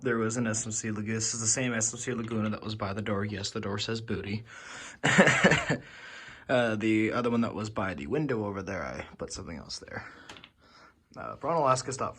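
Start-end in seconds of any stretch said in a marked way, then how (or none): 4.5-6.4 laugh. Real, not acted. Laughs at "door says booty.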